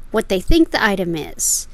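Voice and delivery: ordinary talk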